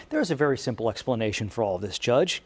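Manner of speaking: mock defendant. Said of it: "he told the judge".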